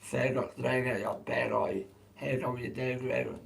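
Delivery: "Feggoch", an egg syllable worked into each word; egg language. Shown as a demonstration. Streamer demonstrating egg language, "Reverend" scrambled with eg sounds. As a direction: garble "How come you dig" with inserted egg sounds. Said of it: "called egg language".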